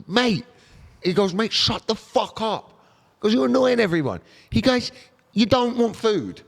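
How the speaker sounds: high-pitched exasperated retelling of a telling-off; indignant telling-off. Retold or imitated. One man retells a bystander confronting a beggar, high mock indignation.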